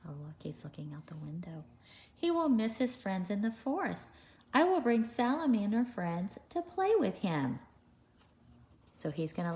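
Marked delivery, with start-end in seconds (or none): 0.0-1.6 quick whispered aside; hushed, fast aside about the picture. Real, not acted. 2.2-7.6 higher, lively storybook reading; animated picture-book read-aloud; reading to children. A narrator's reading.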